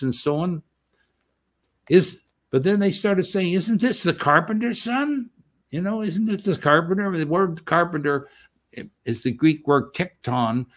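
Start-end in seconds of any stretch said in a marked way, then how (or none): 3.5-5.3 scoffing townsfolk. Retold or imitated. 6.0-7.2 scoffing townsfolk. Retold or imitated.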